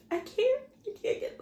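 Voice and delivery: high, strained tearful plea; imitating herself in tears; crying. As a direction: choke it out through tears. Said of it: "at this point, I'm in tears".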